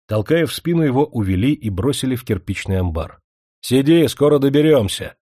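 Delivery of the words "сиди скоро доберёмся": угрожающий окрик. Acted. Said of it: "посулили полицейщики".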